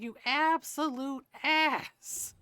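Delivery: slow, drawn-out mock scolding; mock outrage. Telling off a character who hid the truth, mock outraged. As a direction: stretch each word in exaggerated indignation.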